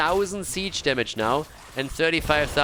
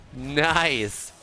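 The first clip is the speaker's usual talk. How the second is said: usual speaking voice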